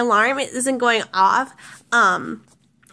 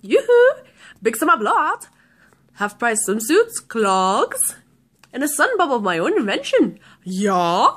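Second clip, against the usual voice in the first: annoying salesman voice; slower than usual. Retold or imitated. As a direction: imitate an annoying, chirpy film salesman. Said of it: "the guy's voice is really, really annoying".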